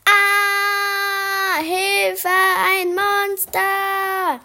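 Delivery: very high, very loud drawn-out scream; screaming with all her might. Acted. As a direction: shriek for help as if a monster is coming. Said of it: "Ich schrie aus Leibeskräften".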